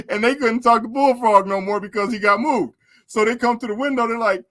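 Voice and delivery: ordinary talk, nothing marked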